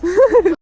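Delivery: high burst of happy laughter; joyful laugh. Real, not acted. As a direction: laugh out loud with relief. Joy at finish.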